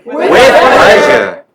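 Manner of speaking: loud, slow, laughing relish; a cackling, delighted yes to the death wish; gleeful laughing offer. Acted. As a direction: savour the joke out loud. Gleeful reply to "kill me.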